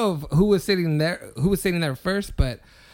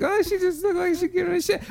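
much higher retold exclamation; onlooker's reaction; 12 semitones higher than usual. Recalling watching the coworker argue, taken aback. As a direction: imitate a taken-aback bystander, pitched way up.

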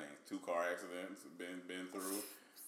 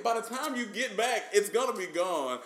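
high and loud, rushing dad; a scared kid pleading with dad to hurry; frantic urging; 12 semitones higher, 11 dB louder than usual. Retold or imitated.

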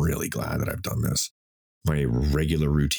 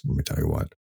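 normal talking voice

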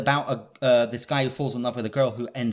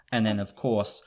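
ordinary talk, nothing marked

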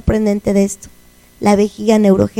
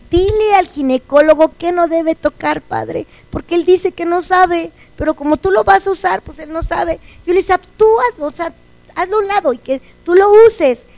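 raised, urgent pleading to God, higher pitch; pleading prayer; 7 semitones higher, 4 dB louder than usual. Retold or imitated. She begs God to guide the surgeon, high pleading prayer. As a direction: beg God earnestly in a high voice.

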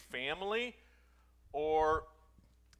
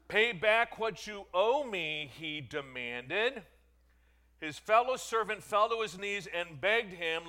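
lifted, louder Bible reading voice; raised scripture reading; 5 semitones higher, 8 dB louder, slower than usual. Acted. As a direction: proclaim the parable to the congregation. Reads scripture aloud.

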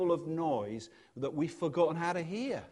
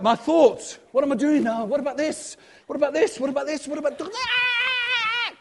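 rising, faster, ending in a high yelp; frantic monkey chatter; 10 semitones higher, 6 dB louder than usual. Retold or imitated. Preacher acting out a frantic monkey mind, climbing to a high yelp. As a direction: let the thoughts pile up until you squeal.

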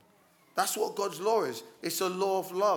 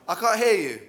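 loud call to the congregation; punchy, much louder prompt; 9 dB louder than usual. Real, not acted. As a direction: raise your voice to demand an answer.

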